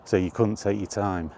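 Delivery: ordinary delivery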